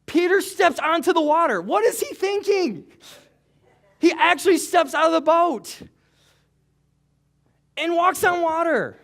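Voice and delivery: high and loud, building excitement; rising preaching fervor. Real, not acted. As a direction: preach it out, amazed at Peter.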